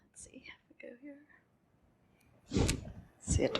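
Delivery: breathy muttered aside to herself; whispered aside. Real, not acted. Presenter whispering to herself while finding the slide.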